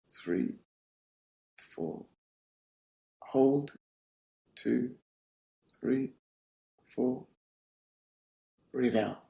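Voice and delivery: soft, unhurried counts with long pauses; guided breathing. Acted. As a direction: count the breaths softly, leaving space between each. Leads a breathing exercise.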